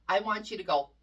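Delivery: ordinary talk, nothing marked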